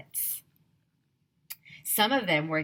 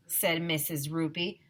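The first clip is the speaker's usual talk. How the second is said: usual speaking voice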